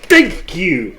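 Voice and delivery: excited. Acted. Cheering agreement in character.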